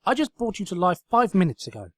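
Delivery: loud high irritated snap; loud exasperated retort; snapped. Acted. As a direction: snap it back at the sofa. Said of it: "snapped Twilight".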